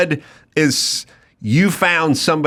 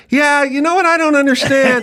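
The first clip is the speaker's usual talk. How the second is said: sister keeps talking; 10 semitones higher than usual. Retold or imitated. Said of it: "my sister".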